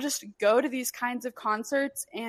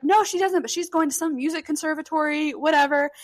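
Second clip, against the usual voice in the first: louder, higher-pitched dismissal; retold emphatic answer; 5 semitones higher, 5 dB louder than usual. Father answering about his daughter, retold emphatic reply. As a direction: brush the question off loudly, pitch raised.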